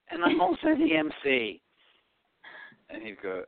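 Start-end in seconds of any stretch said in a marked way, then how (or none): 0.1-1.6 put-on very high, squeaky teenage self; timid young DJ. Shy teen DJ telling the bride's father more, squeaky high. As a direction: squeak it out as a mock-shy kid. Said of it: "I go".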